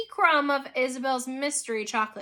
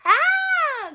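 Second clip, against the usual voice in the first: long, very high scream; piercing "ah" shriek; 7 dB louder than usual. A narrator's reading. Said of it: "the second came from Victoria".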